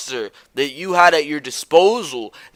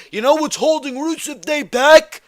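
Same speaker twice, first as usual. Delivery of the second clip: high-pitched, indignant question rising on "back"; incredulous rhetorical question; 9 semitones higher than usual. Real, not acted. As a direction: throw it out high, demanding an answer. Rhetorical question before his answer.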